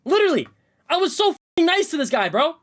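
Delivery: ordinary delivery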